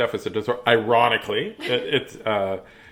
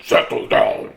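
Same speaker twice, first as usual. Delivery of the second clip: very low, loud, breathy order; deep scary voice; 5 dB louder, slower than usual. Acted. Teacher finally losing patience, deep growl. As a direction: growl it like a monster. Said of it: "And that's when she said".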